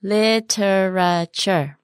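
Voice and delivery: "Literature" in slow separate syllables; slow word model. Shown as a demonstration. Teacher modelling "Literature" for learners, very slowly. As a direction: draw out every syllable of "Literature".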